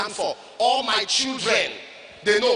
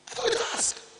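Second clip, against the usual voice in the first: whisper; 12 semitones higher, 7 dB quieter than usual. Acted. Whispered punchline.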